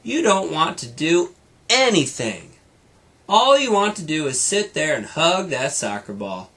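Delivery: lively read-aloud storytelling voice; brighter, fuller storybook reading; story narration. A narrator's reading. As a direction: read it like a bedtime story to a child. Reads story to child.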